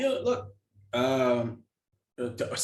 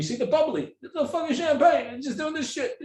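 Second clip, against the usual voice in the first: laughing; 13 semitones higher than usual. Real, not acted. Giddy laugh over champagne fantasy.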